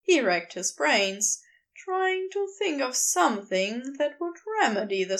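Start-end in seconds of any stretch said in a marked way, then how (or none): none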